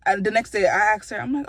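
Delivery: normal talking voice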